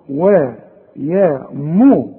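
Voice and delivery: usual speaking voice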